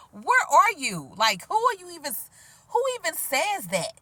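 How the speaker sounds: high, incredulous challenge; mock disbelief. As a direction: question the absent caller in exaggerated disbelief. Mock-confronting the rude callers.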